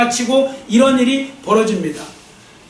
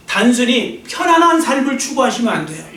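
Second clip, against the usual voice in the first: usual speaking voice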